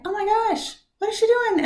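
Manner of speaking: high squeaky mock astonishment; squealed "oh my gosh" in mock shock; shocked disbelief. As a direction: act stunned at the singer, voice way up. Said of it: "I'm like".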